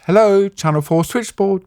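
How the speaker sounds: imitating a cheery switchboard woman; switchboard operator. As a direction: pitch it way up like a receptionist answering. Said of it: "I get the same voice".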